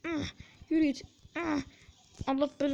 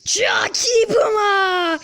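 ecstatic drawn-out cheer; 4 semitones higher, 10 dB louder, much slower than usual. Real, not acted. Gamer cheering his teammate's play, ecstatic drawn-out shout. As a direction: stretch it out loud and high, overjoyed.

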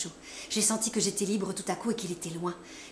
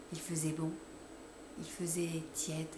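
hushed whisper; 2 semitones lower, 8 dB quieter than usual. A narrator's reading. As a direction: let it drift out, hushed. Tender recollection of the night.

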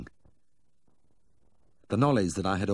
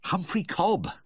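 high, urgent gasp, lightly acted; hurried, high-pitched answer; gasped reply; 13 semitones higher, slower than usual. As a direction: blurt the name as if catching breath. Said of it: "I gasped".

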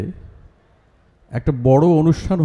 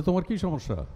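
softly voiced question in regional Bengali accent; 4 semitones higher, 8 dB quieter than usual. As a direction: imitate the tutor's regional Bengali, lightly. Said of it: "উনি একটু আঞ্চলিক ভাষায় কথা বলতেন". (হালকা আঞ্চলিক বাংলা টানে)

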